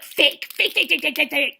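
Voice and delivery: loud rapid-fire repeated jabs; mock relentless taunt. Contestant heckling her rival as fake, mock taunting. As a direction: fire the word out over and over. Acting accuser repeating insult.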